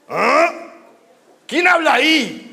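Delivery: high, loud startled challenge; startled samurai. Acted. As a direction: call out in alarm at an unseen voice. (sobresaltado, en voz alta y aguda)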